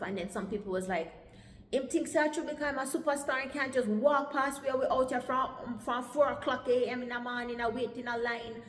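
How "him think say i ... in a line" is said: higher, louder indignant patois rant; cussing complaint. Retold or imitated. People in the visa line complain about being passed, cussing indignantly. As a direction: mimic an aggrieved crowd member. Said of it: "some of them are cuss".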